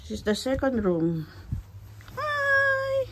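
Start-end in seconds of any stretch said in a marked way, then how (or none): 2.2-3.1 squeaky hi. Acted. Greeting room playfully.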